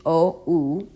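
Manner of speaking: ordinary talk, nothing marked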